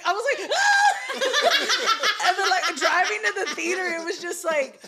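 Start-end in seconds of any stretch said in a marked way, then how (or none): 0.6-2.3 laughing startled yelp, high; a shocked little scream through laughter; mock shocked yelp. Retelling shock at seeing bum.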